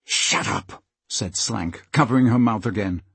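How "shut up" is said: voiceless, harsh whisper; hissed order. Acted. Slank silences Molly covering her mouth, whispered hiss. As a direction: hiss it close to the captive.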